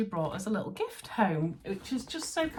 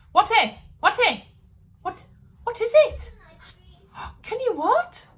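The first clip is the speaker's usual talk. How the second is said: calling downstairs; 13 dB louder than usual. Real, not acted. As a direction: call out down the stairs.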